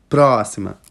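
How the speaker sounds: ordinary delivery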